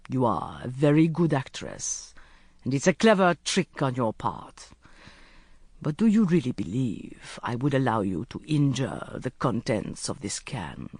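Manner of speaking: low-pitched sheikh, coolly sceptical; curt sheikh. Acted. Sheikh seeing through her trick, curt and low. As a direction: play an unconvinced captor, voice dropped low.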